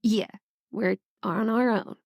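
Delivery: normal talking voice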